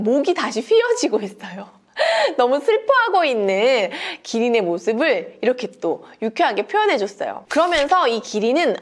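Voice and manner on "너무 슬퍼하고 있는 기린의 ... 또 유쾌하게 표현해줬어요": plain reading voice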